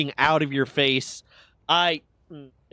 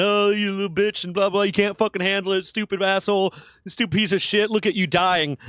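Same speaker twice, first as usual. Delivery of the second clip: higher, jeering instructor taunts; mocking drill instructors; 7 semitones higher than usual. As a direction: imitate the instructors making fun of him. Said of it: "were making fun of me and laughing".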